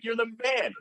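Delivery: high-pitched awed praise; retold admiration. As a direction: imitate hailing a kid as the hero. Praising kid who fixed system.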